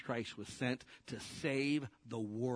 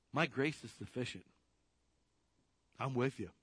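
calm reassurance; 3 semitones lower than usual. Acted. Preacher as Jesus answering worried disciples, calm reassurance. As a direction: settle down into steady, low-key comfort. He's like.

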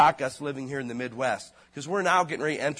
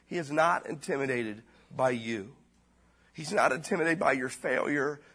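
usual speaking voice

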